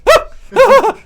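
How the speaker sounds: high shrieking yelp of terror; panicked scream. Acted. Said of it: "He's like".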